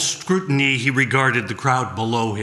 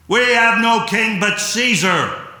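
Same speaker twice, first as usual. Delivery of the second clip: high, loud crowd shout; outraged shout; 9 semitones higher, 4 dB louder than usual. Acted. The outraged crowd roars back at Pilate, high and loud.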